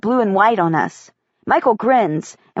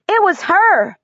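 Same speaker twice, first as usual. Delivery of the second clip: put-on excited little boy; 9 semitones higher than usual. Excited boy points at Caitlin.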